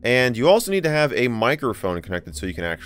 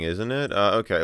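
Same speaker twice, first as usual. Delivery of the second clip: ordinary talk, nothing marked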